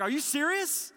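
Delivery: squeaky high disbelief; can't believe the good news, pitch leaping up; disbelieving. Retold or imitated. To the man in line, high and disbelieving.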